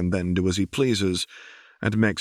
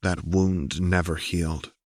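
plain reading voice

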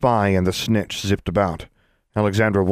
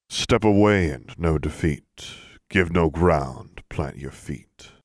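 slow, lower chanted verse; low rhythmic cadence; 3 semitones lower, slower than usual. A narrator's reading. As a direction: chant it like a spell. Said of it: "spoke in a low, rhythmic cadence".

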